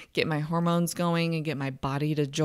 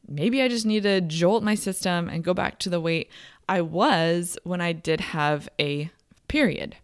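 usual speaking voice

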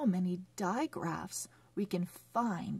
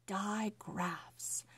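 whispered word; 6 dB quieter than usual. Shown as a demonstration. Teacher whispers new term.

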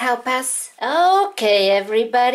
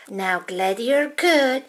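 normal talking voice

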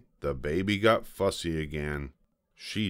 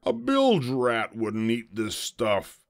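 slow, higher pirate captain voice; disgusted pirate; 4 semitones higher than usual. A narrator's reading. Pirate disgusted by baby food.

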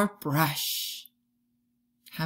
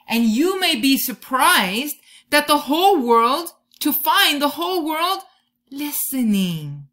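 higher, louder, building wonder; rising excitement; 6 semitones higher, 8 dB louder than usual. Acted. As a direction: swell toward the big reveal. Whole world listening climax.

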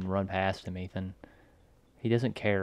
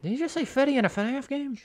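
very high squeaky voice; mock disbelief. Watcher joking about the game's dialogue, high mock disbelief. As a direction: squeal the question as if astonished.

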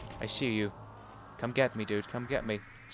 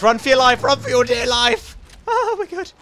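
high, loud cry to run; panicked yell; 8 semitones higher, 5 dB louder than usual. Real, not acted. Gamer shouting at teammate to run for his life. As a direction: yell at your friend to flee.

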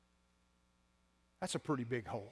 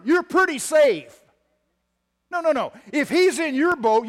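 full-force preaching; 9 semitones higher, 14 dB louder than usual. Acted. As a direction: preach it out to the whole church.

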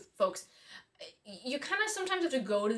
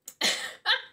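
laugh; 13 semitones higher, 5 dB louder than usual. Real, not acted. Laughing at dog.

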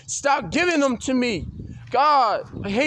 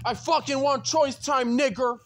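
ordinary talk

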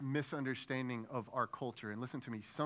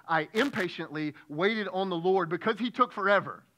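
louder, exasperated grumble; mock impatience; 5 semitones higher, 9 dB louder than usual. Preacher mocking the congregation's impatient prayer, exasperated. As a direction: whine it like you're fed up. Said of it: "we would say".

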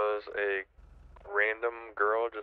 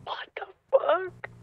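stunned whisper; 5 dB louder, slower than usual. Real, not acted. Reacting to something sudden.

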